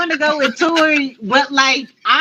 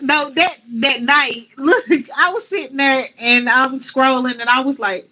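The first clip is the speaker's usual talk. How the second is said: ordinary delivery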